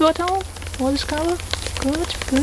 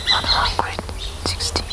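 soft slow whisper; 4 dB quieter, slower than usual. Acted. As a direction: breathe the words out slowly. Whispered reply.

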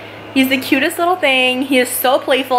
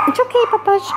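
high, gentle reassurance to a dog; calm the dog down sweetly; soothing pet talk; 11 semitones higher than usual. Real, not acted.

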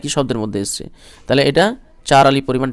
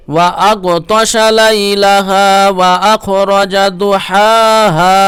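higher, louder, melodic tilawat; Quran recitation; 5 semitones higher, 7 dB louder, much slower than usual. Acted. Teacher recites the verse for students in tilawat. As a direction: lift the verse into a full chant.

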